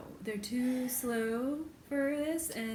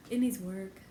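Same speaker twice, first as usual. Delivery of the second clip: ordinary talk, nothing marked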